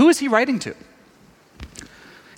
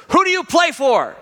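coach challenging; 9 semitones higher, 3 dB louder than usual. Retold or imitated.

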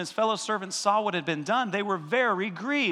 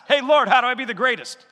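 voicing Peter; 7 semitones higher, 8 dB louder than usual. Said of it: "I think Peter asked".

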